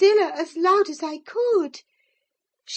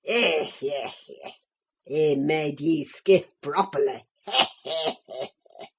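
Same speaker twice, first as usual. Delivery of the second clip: put-on much lower, softer old Yorkshire servant gloating; malignant old Joseph; 4 dB quieter than usual. A narrator's reading. Old Joseph gloats over the beaten boy, low croaking voice. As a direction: croak it out with spiteful glee. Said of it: "a malignant, crackly laugh".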